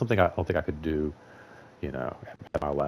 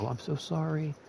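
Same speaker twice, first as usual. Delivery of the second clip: mock sugary apology, pitched way up; kissy-butt apology; 10 semitones higher, 6 dB quieter than usual. Mocking coworkers who apologize to everyone in a sugary voice. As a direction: suck up like a people-pleaser. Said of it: "a little kissy butt to everybody".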